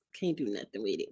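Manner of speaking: defeated grumble; low resigned mutter. Real, not acted. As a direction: give up on it under your breath. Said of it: "complaining about hair".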